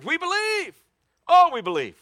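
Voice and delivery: screamed very high and loud, like a frenzied crowd; crowd screaming. Retold or imitated. Preacher voicing the crowd cheering Blondin, a screamed high chant. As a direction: cry it out like ten thousand fans at the falls. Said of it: "ten thousand people screamed".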